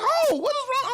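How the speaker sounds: high squeaky cooing voice; baby dolphin voice. Retold or imitated. Dad cooing to his daughter in a dolphin voice. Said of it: "talking like a baby dolphin".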